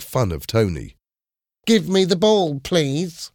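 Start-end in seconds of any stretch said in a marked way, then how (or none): 1.7-3.3 high, silly mimicking voice; stupid mocking voice. A narrator's reading. Bully makes fun of Tony's request, stupid mocking voice. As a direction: make fun of someone with a daft high imitation. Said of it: "imitating Tony in a stupid voice".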